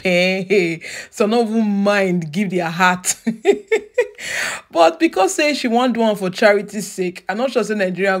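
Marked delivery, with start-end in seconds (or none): 3.2-4.6 a short high burst of laughter. Real, not acted. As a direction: laugh high at your own joke. Amused at her own joke.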